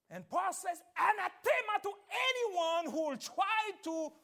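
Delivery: loud, high, fervent sermon voice; preaching at full force. Acted. Preacher driving the point home to the congregation, loud and high. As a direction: preach it hard and lift the pitch.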